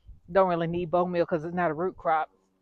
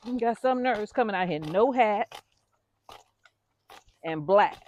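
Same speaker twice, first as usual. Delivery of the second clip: normal talking voice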